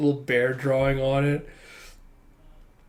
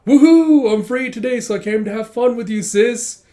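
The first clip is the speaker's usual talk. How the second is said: loud high whoop, then eager chatter; grand entrance; 8 semitones higher, 8 dB louder than usual. Retold or imitated. Brother drops in on his sister, exuberant greeting. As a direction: burst in hammed-up and gleeful, pitched high. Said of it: "making a grand entrance going".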